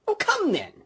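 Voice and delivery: bright, high cheerful reply; gaily. Acted. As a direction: toss it back lightly and gaily. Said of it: "returned the nephew gaily".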